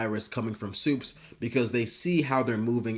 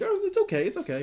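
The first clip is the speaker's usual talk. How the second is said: mock soothing; faster than usual. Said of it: "He's like".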